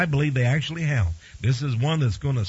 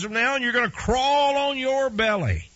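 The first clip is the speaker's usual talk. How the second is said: God with a mild Texas twang, stern and raised; Texas accent for God; 7 semitones higher than usual. Acted. God cursing the serpent in a light Texas accent. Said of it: "that's a little Texas accent for God there".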